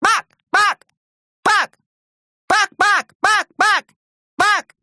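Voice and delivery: very high, loud yaps; short, high-pitched barks; barking dog. A narrator's reading. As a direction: bark the word like an excited little dog. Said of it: "He barked".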